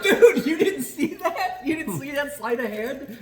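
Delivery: high, slow, laughing disbelief; laughing at their blindness; incredulous call-out. Retold or imitated. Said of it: "And you're like".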